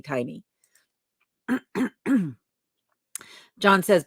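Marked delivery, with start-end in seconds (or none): 1.5-2.3 throat clear. Real, not acted. Clearing throat between comments.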